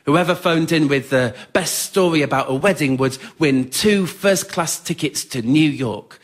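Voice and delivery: ordinary talk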